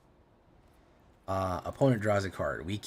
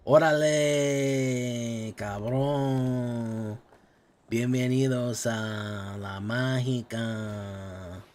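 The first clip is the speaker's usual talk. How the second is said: mock Mexican accent, higher and drawn out; 4 semitones higher, 4 dB louder, much slower than usual. Streamer greeting chat in a mock Mexican accent. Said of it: "doing my Mexican accent".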